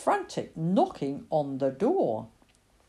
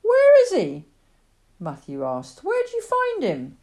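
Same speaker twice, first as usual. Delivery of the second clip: eager; 10 semitones higher, 7 dB louder than usual. Acted. Boy eager for news of cat.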